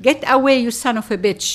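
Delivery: fast, loud furious screech. A narrator's reading. Mother screeching at a man, fast and loud. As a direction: shriek him away, fast and loud. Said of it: "she screeched at him".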